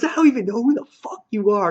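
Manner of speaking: fast, high mock fury; rushed, high-pitched mock outburst; freaking-out rant. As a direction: imitate an exasperated leader ranting. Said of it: "So he's freaking out".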